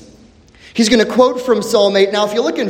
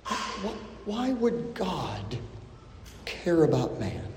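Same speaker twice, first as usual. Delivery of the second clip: slow whisper, much softer; awed whisper; 3 semitones lower, 14 dB quieter, slower than usual. Acted. Preacher asking the congregation why God cares, whispered. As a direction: breathe the question in wonder.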